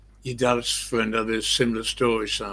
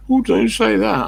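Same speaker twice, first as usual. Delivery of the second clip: high, loud pleading; hammed-up high wail of protest; begging friend; 12 semitones higher, 7 dB louder than usual. Friend begs after being cursed.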